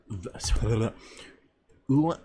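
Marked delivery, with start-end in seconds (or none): none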